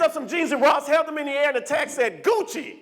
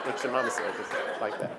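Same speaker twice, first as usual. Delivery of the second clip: low, breathy stunned aside; stunned mutter; 11 semitones lower, 7 dB quieter than usual. Real, not acted. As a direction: mutter it in disbelief.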